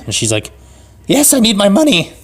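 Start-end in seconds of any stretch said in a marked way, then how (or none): 1.1-2.1 high-pitched granny voice; hammed-up old lady, high. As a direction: put on a high, insistent old woman. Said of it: "this other old lady".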